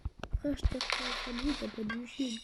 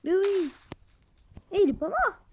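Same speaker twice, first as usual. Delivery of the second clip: higher, louder excited cry; excited shout; 7 semitones higher, 7 dB louder than usual. Real, not acted. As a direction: shout it out in surprise. Surprise in a fight.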